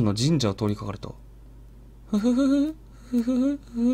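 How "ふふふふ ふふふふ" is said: put-on high, softly eerie child's giggle; a ghostly little laugh from nowhere. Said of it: "って笑い声が聞こえる". (不気味な子供のくすくす笑い)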